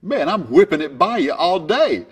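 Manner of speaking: loud, high brag; cocky boast. Retold or imitated. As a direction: crow at him with brash confidence. Cocky boast to long hitter.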